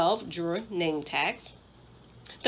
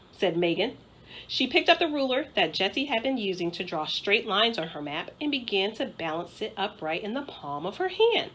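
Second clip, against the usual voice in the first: usual speaking voice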